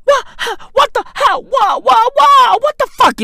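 high-pitched, stammering outburst; squawking disbelief. Acted. Comedian demanding what that sound is, high-pitched bafflement. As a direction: stutter way up high, then come down at the end.

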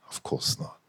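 whispered aside. Real, not acted. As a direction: drop to a breathy aside. Joking aside answer.

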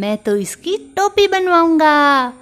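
high, squeaky voice, thrilled with the cloth; a tiny mouse gasping over a treasure; delighted little mouse. Acted. Mouse plans his cap.

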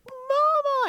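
put-on high, loud child's cry for mom; alarmed child. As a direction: imitate a child calling out in alarm. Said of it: "he was like".

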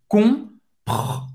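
French "Compr-" with the R scraped in the throat. Shown as a demonstration. Teacher showing learners the French R, "Compr-" scraped in the throat. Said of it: "arranhar essa garganta para fazer esse R".

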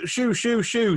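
high-pitched shoo at a little dog. Retold or imitated. Shooing the sniffing dog out of the toilet, high-pitched. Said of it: "So I was like".

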